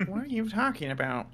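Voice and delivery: a nasal, pinched character voice; nasal researcher voice. Acted. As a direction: talk through a blocked nose, affronted. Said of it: "get your deviated septum fixed".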